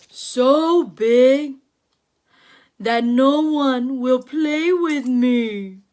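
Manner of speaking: booming storybook whale; big whale voice. Acted. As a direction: play the big whale bragging about his size. The whale speaks again.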